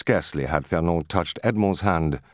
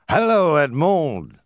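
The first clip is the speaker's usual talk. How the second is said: loud, high, drawn-out hail; slow, ringing shout of greeting; crying out; 11 semitones higher, 6 dB louder, slower than usual. A narrator's reading. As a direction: call across to a friend. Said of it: "cried Caderousse".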